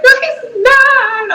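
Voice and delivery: high, slow stubborn protest; high mock protest; whiny denial. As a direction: drag out the no high. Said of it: "And she's like".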